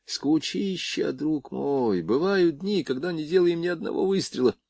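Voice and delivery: bored. A narrator's reading. Borya complains of boredom to friend.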